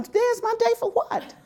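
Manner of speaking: high, quick puzzled laugh; surprised question. Retold or imitated. Coworker says it's her day, she asks high and fast with a laugh. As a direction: act caught off guard, laughing it off. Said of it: "And I'm like".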